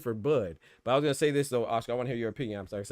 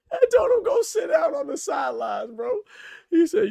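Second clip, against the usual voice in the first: high, loud, laughing words; laughing hard; 9 dB louder than usual. Real, not acted. Retelling coach's sideline order to friends, high and laughing. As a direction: tell it through helpless laughter. Laughing through his own story.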